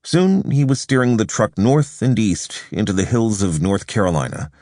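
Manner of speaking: usual speaking voice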